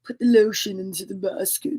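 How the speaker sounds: low, soft creepy killer; soft, low Buffalo Bill menace. Retold or imitated. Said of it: "such a creepy voice".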